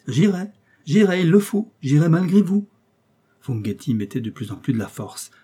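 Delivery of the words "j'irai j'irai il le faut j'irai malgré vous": loud, high-pitched outburst; defiant insistence. Acted. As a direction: insist stubbornly while fighting to get free. Host struggles to break free.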